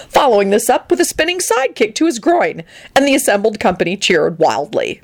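ordinary delivery

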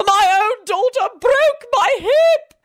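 high, drawn-out wailing scream; screamed from the floor. A narrator's reading. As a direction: wail it in agony, stretching the words. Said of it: "Lady Fanshawe screamed from the floor".

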